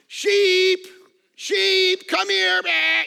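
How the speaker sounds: high, loud sing-song call to the sheep; hollering for sheep who won't come; clumsy loud herding call; mock shepherd call. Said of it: "And so we're like".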